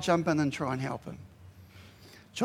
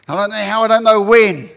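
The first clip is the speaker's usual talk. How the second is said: loud, raised declaration, building to the last word; emphatic shout; 4 semitones higher, 12 dB louder than usual. Acted. Preacher voices worshippers shouting in expectation, loud and fervent. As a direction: cry it out with full conviction.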